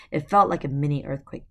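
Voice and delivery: plain reading voice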